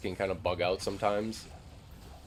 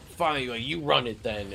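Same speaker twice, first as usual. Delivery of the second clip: huffy player; 5 semitones higher, 5 dB louder than usual. Retold or imitated. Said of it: "he was like".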